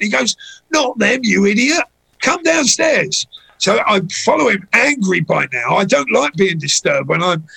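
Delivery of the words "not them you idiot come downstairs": higher, pushy mock scold, slowing on the last words; exasperated scolding. Head of security scolding the owner, exasperated mock scold. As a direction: retell an irritated boss snapping at you, a bit hammed-up. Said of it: "the angry head of security".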